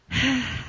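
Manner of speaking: long wordless exhale; weary sigh. Acted. Asked about spiritual life, sighing in reply. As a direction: sigh in place of an answer. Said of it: "by their sighs".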